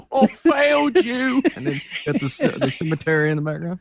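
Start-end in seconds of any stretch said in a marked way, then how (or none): 0.1-1.4 high, hammed-up tearful wail; weepy parody, voice lifted; mock crying impression. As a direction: imitate an actor sobbing out an apology. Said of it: "when he's crying. He goes".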